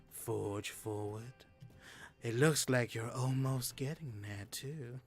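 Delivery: a quiet, mysterious voice from the darkness; dark whisper behind you. Acted. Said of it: "mysterious voice in the darkness".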